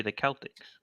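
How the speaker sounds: Celtics said as "KEL-tiks"; "Celtics" opening like "kelp"; hard-C pronunciation. Shown as a demonstration. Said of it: "is that how it's pronounced properly".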